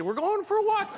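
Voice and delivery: high, squeaky happy dog voice; dog thrilled about a walk, high and squeaky; excited dog. Retold or imitated. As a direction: bounce it up high like a thrilled pup. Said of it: "She's like, excited".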